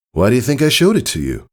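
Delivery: breezy, a bit boastful; friendly older stallion. Acted. Tycho telling Scootaloo about learning it, casually.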